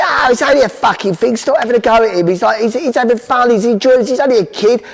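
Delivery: high, lively mock dad protest; dad defending. Dad telling mum to stop nagging the boy, high and dismissive. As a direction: stick up for the kid, dismissive. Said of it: "My dad said".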